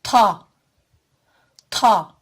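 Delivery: "Ta" as a hard, thick emphatic t, loud; Arabic letter ta. Shown as a demonstration. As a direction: hit "ta" hard and heavy. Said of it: "Kalın, aşikar ve sert okunur". (kalın ve sert "ta")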